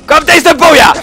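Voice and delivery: a playful shouted Thu'um; mock dragon shout. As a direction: bellow it like a Shout.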